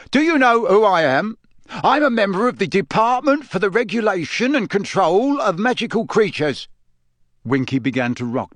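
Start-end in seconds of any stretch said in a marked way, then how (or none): 0.1-6.7 higher-pitched stern official. A narrator's reading. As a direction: lay down his authority, cold and firm. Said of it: "said Mister Diggory sternly".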